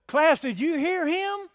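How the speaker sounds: high, loud, slow mock scold to the class; stern teacher calling out. Teacher shaming a student in front of the class, high mock scold. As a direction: call out the class like a strict teacher. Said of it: "'cause she would say".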